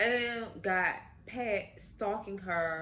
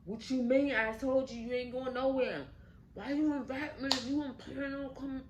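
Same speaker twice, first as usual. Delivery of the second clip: angry retort; 6 semitones higher than usual. Retold or imitated. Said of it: "Pat get mad and upset and angry".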